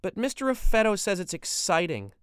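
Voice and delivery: a daughter protesting to her father; protesting teen girl. Acted. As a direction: argue back like a sulky teen. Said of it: "Betty countered".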